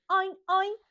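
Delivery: playful spoken pig oinks; imitación de cerdo. Shown as a demonstration. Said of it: "El cerdo hace". (gruñido juguetón de cerdo)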